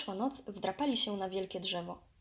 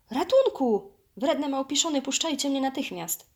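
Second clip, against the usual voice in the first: loud, high-pitched indignant shouting; yelling for help; 6 semitones higher, 7 dB louder than usual. A narrator's reading. Boy struggling in the monkeys' grip, yelling. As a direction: cry out and demand release. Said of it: "wrzeszczał".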